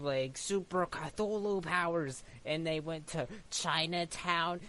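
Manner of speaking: normal talking voice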